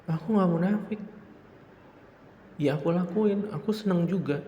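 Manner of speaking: high, pushed defiant confession; defiant admission. Acted. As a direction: own it brazenly, voice up.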